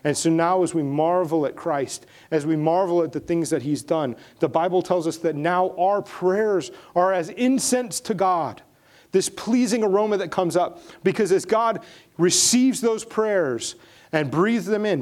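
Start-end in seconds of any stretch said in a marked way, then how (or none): 4.4-15.0 higher, louder preaching; impassioned preaching. Acted. Pastor paints prayers rising as incense, preaching higher. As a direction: lift the congregation toward the image.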